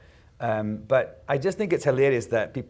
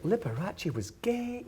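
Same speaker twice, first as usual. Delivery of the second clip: imitating mother-in-law, higher and slow, astonished; mock surprise; 9 semitones higher, 6 dB quieter, slower than usual. Husband's mum learning the news, mock astonished, higher. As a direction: play the naive shocked relative. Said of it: "was like".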